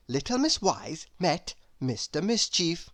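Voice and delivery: ordinary delivery, nothing marked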